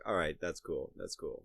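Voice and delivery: low, soft giving-in mumble; resigned kid. Retold or imitated. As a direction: shrug it off quietly, defeated. Resigned kid reply to dad.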